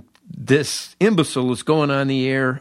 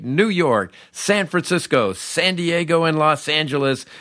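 high, bright-voiced on-air DJ patter; radio DJ announce; 4 semitones higher than usual. The rookie going live on WLS, hammy DJ announce. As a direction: sell it like a big-station jock.

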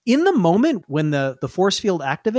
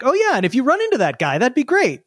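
breezy, offhand, higher voice; casual NPC voice; 7 semitones higher than usual. Retold or imitated. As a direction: toss it off lightly. GM voices NPCs' casual dismissal.

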